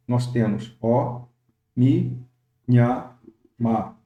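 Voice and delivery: "ó, mi, nha, ma" split into separate syllables; slow syllable-by-syllable "ó mi nha ma"; syllable counting. Shown as a demonstration. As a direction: count out "ó, mi, nha, ma" one beat each. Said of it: "E na contagem de sílabas".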